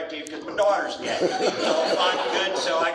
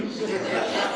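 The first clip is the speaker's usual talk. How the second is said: laugh; 4 semitones higher than usual. Real, not acted. Laughs at own joke.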